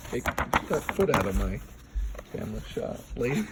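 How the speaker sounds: soft, fast half-whisper; playful hushed scold. Real, not acted. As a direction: shoo them off quietly. Shooing someone from camera.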